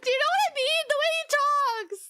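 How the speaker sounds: high, loud giggly question; giggly excitement. Real, not acted. Asking her viewers while still laughing at her impression.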